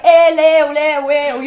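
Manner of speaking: loud, high mock Lebanese chatter; Lebanese accent. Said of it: "if it's a Lebanese".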